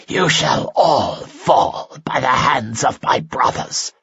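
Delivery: slow, spat monster threat; the dying creature threatening its captors, spitting. A narrator's reading. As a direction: spit the words with hatred. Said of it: "spat the creature".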